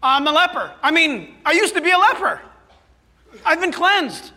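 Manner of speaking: high, loud, eager leper voice. Acted. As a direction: plead your case as the healed man. Acted leper speaking to priest.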